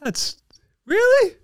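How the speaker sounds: "really" shot way up in mock shock; incredulous disbelief. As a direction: imitate being floored by the texts. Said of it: "I'm like".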